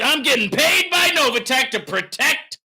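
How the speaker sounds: high, loud sarcastic shout; mock hollered confession. Streamer mocking the claim he is paid, hollering. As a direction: proclaim the sham confession at full voice. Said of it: "you keep hollering".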